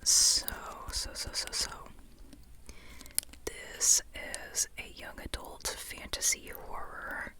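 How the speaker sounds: ordinary delivery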